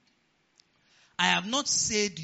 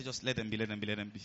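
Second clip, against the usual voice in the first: soft, low, unbothered advice; hushed calm reassurance; 8 semitones lower, 7 dB quieter, faster than usual. Retold or imitated. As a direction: let it go, quietly, no fuss. Pastor calmly telling members.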